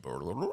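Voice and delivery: low imitated stomach gurgle; soft, low rolling growl noise; stomach rumble. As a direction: rumble like an upset belly. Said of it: "my stomach said".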